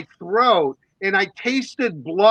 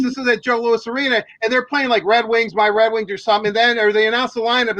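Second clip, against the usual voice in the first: ordinary talk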